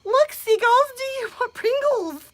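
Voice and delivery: high sing-song call to the birds; high playful calling out; cooing kids. Retold or imitated. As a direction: coax the seagulls like a child. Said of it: "What does my crew do?".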